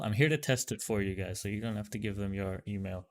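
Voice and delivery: ordinary delivery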